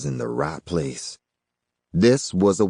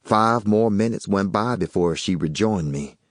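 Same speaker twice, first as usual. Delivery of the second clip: ordinary delivery, nothing marked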